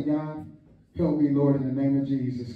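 low, hushed plea; brief prayer. Retold or imitated. Preacher shows stopping mid-struggle to pray, low quiet plea. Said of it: "do is stop and say".

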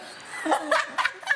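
giggling; real laugh. Real, not acted. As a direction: burst of high laughter. Laughing at pronunciation drill.

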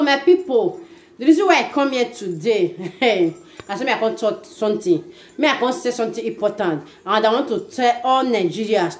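usual speaking voice

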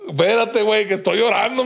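high, laughing imitation of a scared friend; laughing retold reply. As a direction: quote him while cracking up. Scared friend answers while praying. (imitación entre risas, aguda, del amigo asustado)

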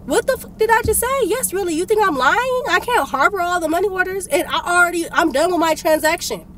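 normal talking voice

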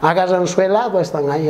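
ordinary talk, nothing marked

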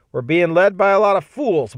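loud, high angry outburst; angry shout. Acted. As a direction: shout it out at the fools in charge. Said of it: "He shouted in loud sentences".

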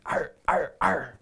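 playful growling arr sounds; gnashing growls. Acted. Narrator playing wild things gnashing teeth, playful growls. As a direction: growl like chomping monsters. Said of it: "They gnashed their terrible teeth".

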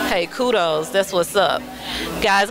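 normal talking voice

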